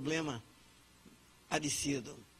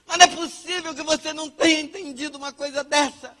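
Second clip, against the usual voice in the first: irritated outburst; 8 semitones higher, 10 dB louder than usual. Acted. Acting an irritated, immature adult.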